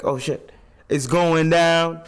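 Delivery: loud, high, slow drawn-out exclamation; mock hyped reaction. Rapper hears gunshots in the song, mock hyped reaction. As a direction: stretch it out loud like a hype man.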